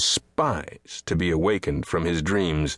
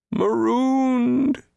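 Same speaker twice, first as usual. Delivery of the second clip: high, drawn-out mournful call; anguished cry; 3 dB louder than usual. A narrator's reading. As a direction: cry out like a lost soul. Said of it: "he cried".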